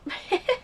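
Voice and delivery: a short high laugh that cracks into crying; a brief sob-laugh that rises sharply in pitch; laughing through tears. Real, not acted. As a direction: laugh at the bad news while about to cry. Crying while recounting eye treatment.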